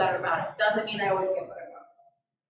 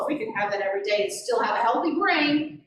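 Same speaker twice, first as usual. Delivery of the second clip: normal talking voice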